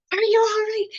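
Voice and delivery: loud, high-pitched mock yell; mom's yelling, imitated loud and high; retold yelling. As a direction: yell it like an alarmed mother. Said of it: "my mom was yelling at me".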